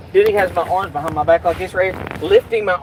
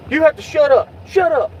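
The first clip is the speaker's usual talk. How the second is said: much higher, louder mocking cop voice; 11 semitones higher, 4 dB louder than usual. Imitating the cop telling him to shut up: much higher mocking voice. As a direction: mimic the cop snapping at him. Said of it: "He's like".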